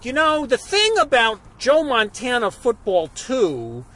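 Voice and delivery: blogger impression. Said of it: "He's like".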